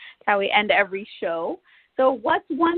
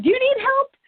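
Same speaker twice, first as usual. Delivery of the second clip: much higher, eager little question; eager offer; 4 dB louder than usual. Retold or imitated. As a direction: quote her eager offer, pitched way up. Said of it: "she said".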